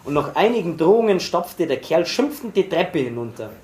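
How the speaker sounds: ordinary delivery, nothing marked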